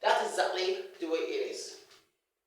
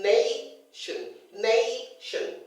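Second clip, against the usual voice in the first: NAAY-tion, long raised first syllable; stress on first syllable; 5 semitones higher, much slower than usual. Shown as a demonstration. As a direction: draw out the start of nation, let tion fall away. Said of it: "you've applied extra force".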